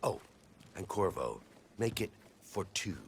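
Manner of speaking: low, hushed plotting; covert orders. Acted. Plotter phoning a henchman about Plan B, hushed covert orders. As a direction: keep it down as you give covert instructions.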